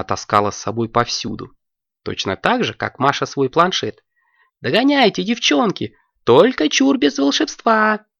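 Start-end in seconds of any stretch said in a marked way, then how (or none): 4.6-8.1 put-on high, bright girlish call; a high girl's voice shouting to her friends; excited girl. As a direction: call out gleefully as you race off. Алёнка shouts, racing off.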